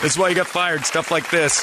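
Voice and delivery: exasperated scolding. Retold or imitated. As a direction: hammer it home in exasperation at a colleague. Scolding Jeremy in retold argument.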